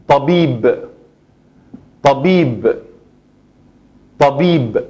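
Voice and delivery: Tabib said slowly, final B closed and stopped; Arabic word model. Shown as a demonstration. Teacher models Tabib again for students, clearly. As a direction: repeat Tabib with a crisp final B. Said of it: "B, b, bib".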